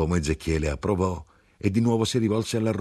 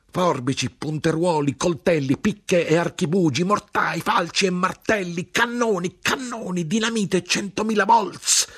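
climbing ever higher, loud, to a shrieking peak; frantic escalating rant; 13 semitones higher, 4 dB louder than usual. A narrator's reading. The grinder lists weapons against the offended world, frenzied climbing rant. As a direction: pile up the weapons in growing fury.

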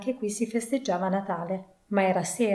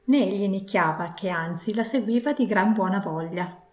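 ordinary delivery, nothing marked

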